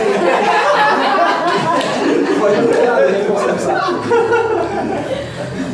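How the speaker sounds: long, loud, high laughing fit. Real, not acted. Comedian laughing at crowd's reaction, high loud laugh.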